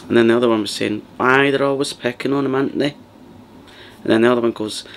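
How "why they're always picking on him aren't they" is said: lightly higher Glaswegian sympathiser; a hint of a Glaswegian pal taking her side; Glaswegian best friend. Best friend agreeing with the mum: mild Glaswegian sympathy. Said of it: "good kind of rendition ... of what they sound like".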